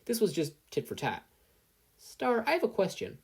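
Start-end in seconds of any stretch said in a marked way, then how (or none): 2.0-3.2 put-on much higher young voice; boyish Izuku. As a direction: ask eagerly. Eager boy asks.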